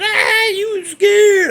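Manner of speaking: high, drawn-out mocking taunt; imitated jeering. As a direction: mimic a laughing prankster's tease. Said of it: "them guys are all over there laughing".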